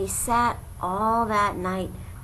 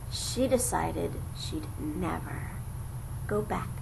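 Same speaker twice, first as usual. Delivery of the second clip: hushed whisper; 4 semitones lower, 7 dB quieter than usual. A narrator's reading. Narrator revealing Maisie's betrayal, hushed whisper. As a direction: drop to a soft whisper. Bird abandons nest secretly.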